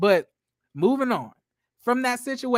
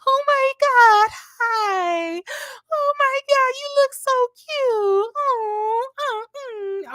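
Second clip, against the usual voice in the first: much higher, slow gushing fake friendly squeal; fake sweet greeting; 3 dB louder, much slower than usual. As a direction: gush over each guest like a phony hostess. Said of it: "Melody starts doing that fake bullshit".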